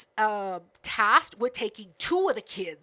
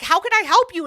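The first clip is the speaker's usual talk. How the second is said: higher and louder, frantic; urgent offer; 8 semitones higher, 8 dB louder than usual. Retold or imitated. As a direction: call it out over the water. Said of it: "she's like".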